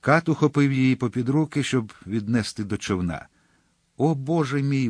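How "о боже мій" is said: narrated outcry of despair; despairing exclamation. A narrator's reading. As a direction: cry out in understated despair. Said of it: "вигукнула вона".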